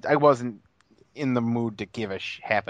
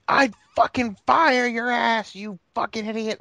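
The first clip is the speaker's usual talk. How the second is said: mock scream; 9 semitones higher than usual. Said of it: "And she's just screaming".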